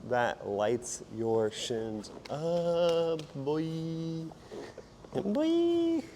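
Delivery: lost voice, hoarse; hoarse from losing his voice. Real, not acted. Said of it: "Sorry about my voice, I kinda lost my voice".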